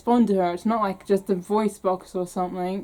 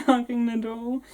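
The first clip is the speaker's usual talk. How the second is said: usual speaking voice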